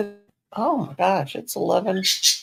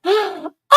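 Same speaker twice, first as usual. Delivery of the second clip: delighted gasp; 11 semitones higher, 6 dB louder than usual. Real, not acted.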